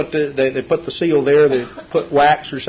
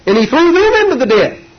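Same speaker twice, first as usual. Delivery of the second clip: much higher, louder declaration; emphatic preaching cry; 12 semitones higher, 6 dB louder than usual. Acted. Preacher tells how the king threw the accusers into the den, crying it out. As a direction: cry out the judgment on them. Climax of the lion story.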